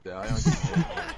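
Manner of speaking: slow, amused repeat through a laugh; chuckling while repeating it. Real, not acted. Echoing teammate's joke, laughing.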